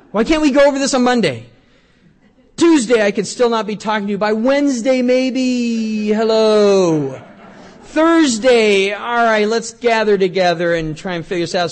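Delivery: mock-exasperated preacher's rant; loud preaching. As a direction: drive the frustration home from the pulpit. Preacher retelling a weekend quarrel.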